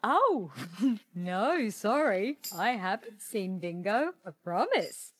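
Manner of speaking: evasive, badly covered-up innocence; overdone innocent denial; shifty. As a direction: feign innocence while hiding something. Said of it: "Now you're acting shifty".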